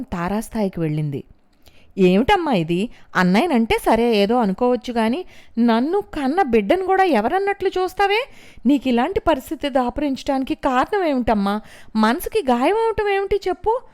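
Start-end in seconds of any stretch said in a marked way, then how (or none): 2.0-13.8 high, loud angry demand at her mother; furious daughter, much higher and louder; loud confronting. A narrator's reading. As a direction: shout the questions, accusing. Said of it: "నిలదీస్తున్నట్లు పెద్ద గొంతుతో హడావిడి చేసింది".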